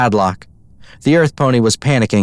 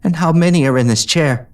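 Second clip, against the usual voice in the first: gentle; 6 semitones higher than usual. A narrator's reading. Gentle hoof on cheek.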